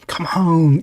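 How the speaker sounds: put-on cooing come on as if to a child; coaxing. Coaxing someone to eat.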